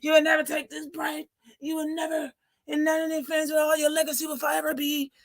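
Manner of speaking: high, shouted, hammed-up sermon voice; mock a wired preacher ranting; coked-up preacher. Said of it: "talking like a coked up fucking preacher".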